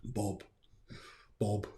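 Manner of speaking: fish talk. Pretend. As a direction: pop the word like a goldfish, half laughing. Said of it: "They all talk like fish".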